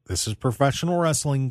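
normal talking voice

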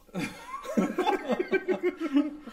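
loud, high-pitched burst of laughter; high cackling laugh. Real, not acted. Co-host cracking up over the doll scene, cackling laugh.

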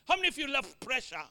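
ordinary delivery

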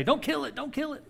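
high, mocking plea of the snake fans. Snake group members pleading for the snake, high and mocking. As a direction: squeak it like a pleading snake lover. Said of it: "they say".

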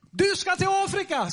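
high, forceful proclamation; proclaimed prophecy. Retold or imitated. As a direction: declare it as God's word. Re-enacting the prophecy shouted at Steve.

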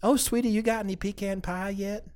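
Southern hostess softly offering pie to a guest; old lady Southern accent. Retold or imitated. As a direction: sweetly ask in a Southern grandma accent. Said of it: "doing the old lady Southern".

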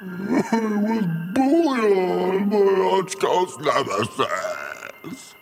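high, slow, mushy ghost mumble; garbled ghost voice. Acted. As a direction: slur it so it can't be understood. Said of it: "I have no idea what you just said".

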